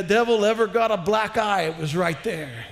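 ordinary talk, nothing marked

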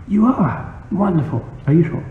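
put-on high, odd old-man voice, thrilled; a squeaky little priest delighted by a convert. Priest reacts to the narrator wanting to join, high and delighted.

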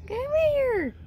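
high calling voice. Real, not acted. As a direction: call the cat to come. Calling the cat.

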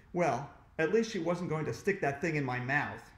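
plain reading voice

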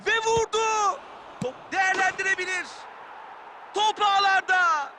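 high shout of the goal; screaming football commentator. Real, not acted. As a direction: yell the shot, then stretch it. Shot and goal called live.

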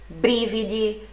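"Brividi" slowly, stressed BRI-vi-di; first-syllable stress. Shown as a demonstration. Teacher repeating for students, slow stressed BRI in "Brividi". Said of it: "sílaba forte na primeira sílaba".

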